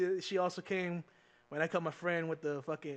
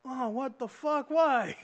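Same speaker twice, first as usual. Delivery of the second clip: drawn-out, higher-pitched mock disbelief; indignant protest; 7 semitones higher, 5 dB louder, much slower than usual. Student called out by teacher in class, indignant protest. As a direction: protest loudly, stretching out the words.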